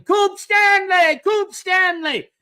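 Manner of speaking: high, drawn-out celebratory shout; re-enacted drunken victory yell. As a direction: chant it at the top of your voice. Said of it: "jumping up on the bed yelling".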